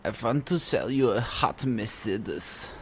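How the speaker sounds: put-on slow German car salesman accent; hammed-up German accent, drawn out; mock German accent. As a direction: pitch it slowly in comic German. Acts German car seller after accent talk.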